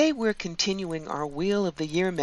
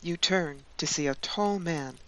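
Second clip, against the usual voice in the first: guided meditation; 6 dB quieter than usual. Acted. Guide leading listeners into a Yule winter journey, guided meditation. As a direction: guide them gently, leaving long spaces.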